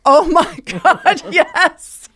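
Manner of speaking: high, loud laughing burst of disbelief; cracking up while telling him he's overthinking. Retold or imitated. To the man she's dating, laughing in disbelief. Retelling her amused disbelieving reply.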